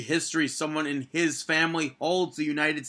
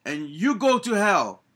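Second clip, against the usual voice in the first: higher, louder mock Lana promo voice; imitating Lana; 6 semitones higher, 4 dB louder than usual. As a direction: mimic her stilted accented promo line. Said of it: "She was like".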